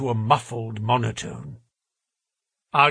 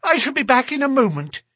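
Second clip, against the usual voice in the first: nervous little man voice; 12 semitones higher, 4 dB louder, faster than usual. A narrator's reading. Thaddeus voiced in story.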